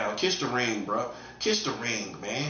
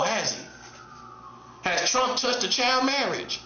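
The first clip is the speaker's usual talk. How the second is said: high-pitched, needling rhetorical questions; mock taunting; 7 semitones higher than usual. Commentator taunting about child marriage inaction, high-pitched mockery. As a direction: goad them in a raised, singsong pitch.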